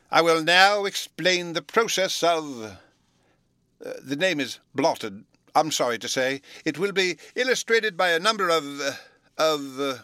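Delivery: a muddled professor reading his notes aloud; fussy professor. A narrator's reading.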